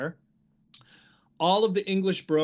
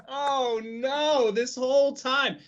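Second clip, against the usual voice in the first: shocked realization; 7 semitones higher than usual. Retold or imitated. Realizing the nickname's joke.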